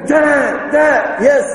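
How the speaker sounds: put-on very high childlike Dad; child calling. Small child calling dad again, very high.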